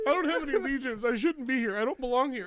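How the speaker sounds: high, loud self-doubting rant; escalating comic impostor meltdown; mock panicked outburst. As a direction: shout the insecurities in hammed-up despair. Acting out impostor panic for laughs.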